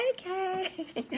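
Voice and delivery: hammed-up grudging agreement; reluctant okay. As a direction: give in with a playful sigh. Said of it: "I'm like".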